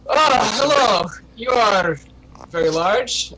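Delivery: high, loud, drawn-out hello; startled greeting. Acted. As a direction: greet him as if taken aback by his size. Brimstone greets the looming big man.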